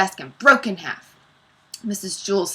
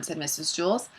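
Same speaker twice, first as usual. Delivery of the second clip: usual speaking voice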